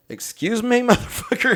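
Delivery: high, laughing mock politeness. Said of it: "It was something like".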